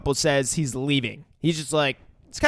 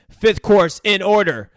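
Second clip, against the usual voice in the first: loud, slow kitchen call; chef calling an order; 5 semitones higher, 7 dB louder than usual. Retold or imitated. Chef to his kitchen staff, commanding call. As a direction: announce the course to the whole kitchen. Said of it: "Chef walks back into the kitchen and says".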